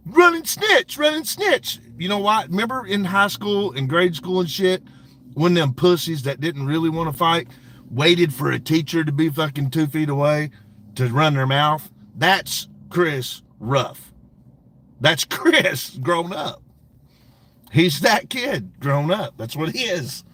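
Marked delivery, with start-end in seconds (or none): 0.1-1.8 high, loud sneering taunt; mock taunt. Streamer mocks rival's schoolyard taunt. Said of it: "he goes". 12.1-19.3 slow emphasis. Real, not acted.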